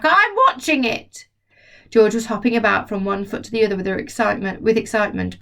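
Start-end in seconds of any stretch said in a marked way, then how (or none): 0.0-1.3 grandma voice. Acted. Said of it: "the old girl shouted back".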